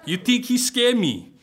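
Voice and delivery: loud, high, slow mock-tough scoff; slow, scornful tough-guy taunt; scoffing challenge. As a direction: dare anyone to call him scared. Oz scoffing at Darth Vader.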